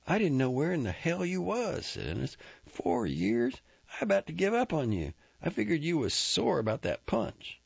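rough rancher voice pushed high; Ennis, relieved. Acted. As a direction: blurt out the worry all at once. Ennis admits worry.

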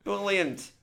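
very high, squeaky Sheffield "Brilliant"; hammed-up Northern English exclamation; Sheffield accent. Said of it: "Is that the right accent?".

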